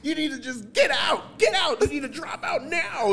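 hammed-up shouting counselor kicking him out; mock furious counselor. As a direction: yell like an outraged counselor through laughter. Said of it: "then she was like".

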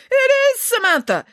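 high, loud cry of recognition; exclaimed. A narrator's reading. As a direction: exclaim in amazed discovery. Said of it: "exclaimed Nanny Piggins".

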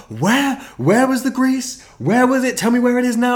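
put-on very high, loud frantic girlfriend; hammed-up girlfriend demanding where the grease is; panicked clean freak. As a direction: shriek it like a horrified neat freak. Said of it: "she's a clean freak".

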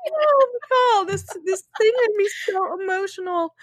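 high, breaking voice through tears and laughter; really crying, half laughing; tearful crying. Real, not acted. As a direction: choke it out while crying. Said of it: "I'm crying talking about it".

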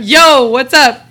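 slow, loud, hammed-up bro greeting; mock casual greeting. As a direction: play it like a too-cool dude saying hi, dragging it out. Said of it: "I did not say that to my nat".

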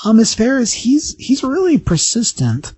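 high, hesitant receptionist voice; slow down apologetically, pitched well up; receptionist on the intercom. Acted. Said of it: "the voice on the box says".

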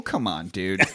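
ordinary talk, nothing marked

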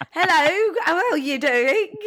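hammed-up English greeting, pitched high and loud; badly faked English accent, high and loud. Shown as a demonstration. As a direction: attempt an English accent and overdo it. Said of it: "I couldn't do an English accent".

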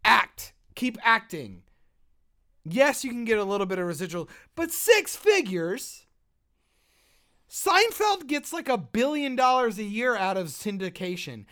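loud, high, indignant rant with slow punches; angry rant. Real, not acted. Ranting to his cohost about actors' pay, loud and furious. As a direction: hammer each point home in outrage. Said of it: "I'm so angry".

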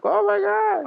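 very high astonished squeal; mock shock. Imagined crowd reacting to weirdness, mock shock.

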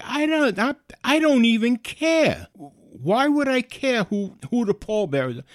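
high, loud, fed-up protest; retold indignant outburst; exasperated. Retold outburst about pallbearers.

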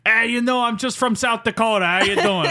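high, hammed-up New York accent; Bronx accent. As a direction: play a cocky Bronx guy. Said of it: "you talk like you're from the Bronx".

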